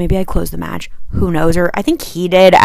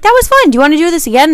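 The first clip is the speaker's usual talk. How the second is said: much higher, louder, chirpy imitation of him; hammed-up oblivious guy; mock cheerful date; 8 semitones higher, 4 dB louder, much faster than usual. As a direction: parody his clueless cheer. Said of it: "He's like".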